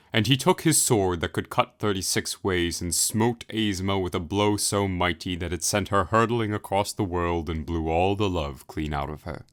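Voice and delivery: ordinary delivery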